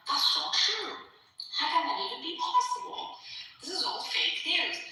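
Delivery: high-pitched imitation of a denier; mock incredulous denial. Imitating a man denying Tel Aviv is on flames, high incredulity. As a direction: pitch it up in disbelief, mimicking him.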